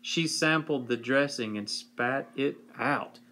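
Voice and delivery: plain reading voice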